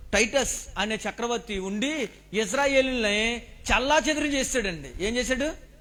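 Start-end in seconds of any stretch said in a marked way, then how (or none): none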